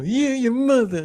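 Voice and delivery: high, loud New York comeback; angry New Yorker. Acted. New Yorker trading street insults, loud angry accent. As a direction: fling it back in a mock New York yell. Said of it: "your typical New Yorker sounds like what?".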